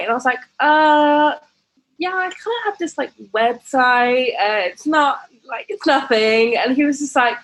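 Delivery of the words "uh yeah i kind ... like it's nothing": high, shy brush-off; coy. Retold or imitated. Admitting the website to him, high and coy. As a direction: mumble it away like it's nothing. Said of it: "I just remember being so coy".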